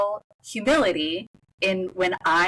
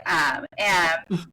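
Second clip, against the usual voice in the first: ordinary talk, nothing marked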